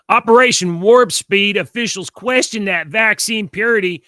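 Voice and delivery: loud news anchor headline read. Pretend. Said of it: "I'm gonna do my best".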